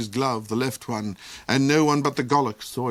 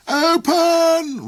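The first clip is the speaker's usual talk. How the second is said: high, loud commanding roar; 8 dB louder than usual. A narrator's reading. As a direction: bellow the door open as the Duke. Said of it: "roared the Duke".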